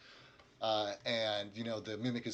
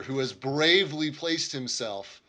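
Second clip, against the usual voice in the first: ordinary delivery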